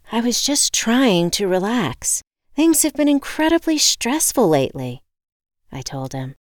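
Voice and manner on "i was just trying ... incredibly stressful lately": higher, emphatic self-justification; defensive exasperation. A narrator's reading. Woman explains her stress to the locksmith, exasperated. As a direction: plead your case, building higher and louder.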